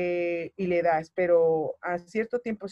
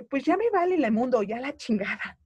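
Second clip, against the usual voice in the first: exasperated laugh; 6 semitones higher than usual. Retold or imitated. Exasperated outburst retold, laughing.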